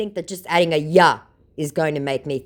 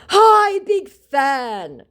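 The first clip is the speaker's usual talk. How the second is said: high, loud, gushing hello; hammed-up cheery fake celebrity; mock scammer greeting; 13 semitones higher, 6 dB louder, much slower than usual. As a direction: gush like a fake star. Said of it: "said my scammer".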